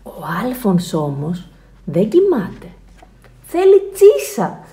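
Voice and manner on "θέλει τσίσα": high, slow, loud announcement; urgent need. A narrator's reading. Narrator reveals Alfons needs to pee, urgent. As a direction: stretch it out urgently.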